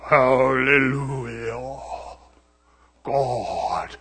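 preacher mocking a minister's sudden anointing voice; changed anointed voice. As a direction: drop into a deep drawn-out holy voice. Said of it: "as soon as the anointing".

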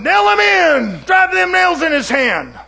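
drawn-out, high, full-force yell; yelling mob member. Acted. Preacher acting the crowd at the crucifixion, slow high yelling. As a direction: bellow it slowly like one of the crowd. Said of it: "right there yelling".

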